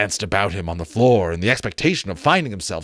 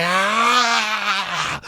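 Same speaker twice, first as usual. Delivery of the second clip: ghost cry; 10 semitones higher than usual. Acted. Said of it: "set up another cry".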